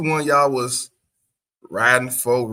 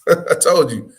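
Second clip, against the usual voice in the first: laughing; 6 semitones higher, 6 dB louder than usual. Real, not acted. Gloating reaction, laughing.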